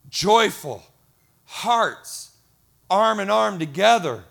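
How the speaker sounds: loud, emphatic pulpit delivery; impassioned preaching. Real, not acted. Pastor telling congregation why they serve, impassioned. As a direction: preach it with full force, punching key words.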